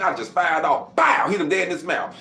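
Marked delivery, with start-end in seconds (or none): 0.9-1.3 punch sound effect. Retold or imitated. Imitating his punch landing.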